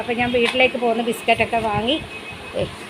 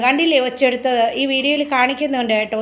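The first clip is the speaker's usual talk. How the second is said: ordinary talk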